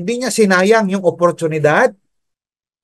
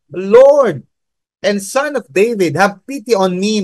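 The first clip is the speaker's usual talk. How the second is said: higher, pleading cry that opens loud; pasigaw na pagsusumamo; 5 semitones higher, 3 dB louder than usual. Retold or imitated. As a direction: call out "Lord" loudly, then ease off on a raised pitch. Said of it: "came out shouting," nagsisisigaw". (mataas na panawagang humihingi ng awa)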